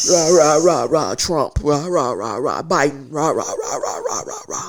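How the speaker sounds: loud, rhythmic rant babble, dropping in pitch; mock ranting. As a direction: parody an angry political tirade winding down. Mocking political ranting as babble.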